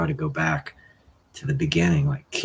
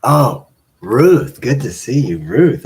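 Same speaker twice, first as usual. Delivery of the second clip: excited greeting; 9 dB louder than usual. Real, not acted. Greeting a chat viewer warmly.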